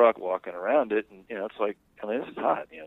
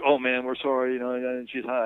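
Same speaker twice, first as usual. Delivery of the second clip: higher, louder mock apology; flustered apology; 5 semitones higher, 4 dB louder than usual. Two guys apologizing to her husband, flustered. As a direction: imitate guys apologizing in a hurry.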